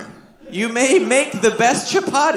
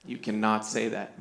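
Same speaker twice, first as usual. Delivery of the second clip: put-on low, soft admonishment to a child; quiet, firm dad voice; hushed parental warning; 11 semitones lower, 12 dB quieter than usual. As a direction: drop right down and quietly correct your kid. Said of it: "I'm like".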